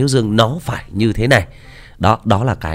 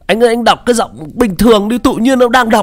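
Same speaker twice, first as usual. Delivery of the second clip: put-on much higher, louder, whiny frightened girl; scared fan; 13 semitones higher, 4 dB louder than usual. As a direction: plead like a spooked young fan. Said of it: "có nhiều bạn comment rằng là". (giọng cô gái sợ hãi, cao và nhõng nhẽo)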